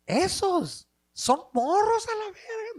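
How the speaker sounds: high, loud, drawn-out mock girl voice; paisita girls. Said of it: "The little paisita girls".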